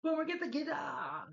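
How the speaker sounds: very high, drawn-out mock shout; Lindy Carter impression. Retelling the principal ordering Boomer, high urgent mock yell. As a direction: stretch a hammed-up cry high overhead. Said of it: "And Lindy Carter's like".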